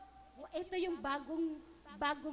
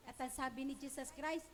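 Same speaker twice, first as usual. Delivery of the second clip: normal talking voice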